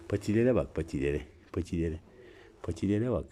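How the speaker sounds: hushed, tender pet talk; soft cooing. Real, not acted. Owner cooing quietly over her cat's paws. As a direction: coo softly over the little paws.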